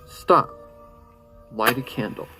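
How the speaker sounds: ordinary delivery, nothing marked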